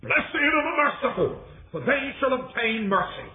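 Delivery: high, ringing proclamation; high-pitched declaimed blessing; retold cry to followers. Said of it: "he cries".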